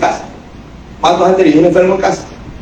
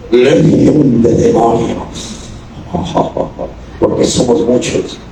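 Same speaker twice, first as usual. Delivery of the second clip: slow, low, hissing demon voice with a cackle; voice from beyond the grave; 6 semitones lower, slower than usual. Acted. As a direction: growl it like a demon laughing from the grave. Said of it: "es una voz de ultratumba".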